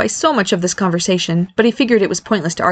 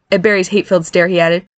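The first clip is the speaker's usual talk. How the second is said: usual speaking voice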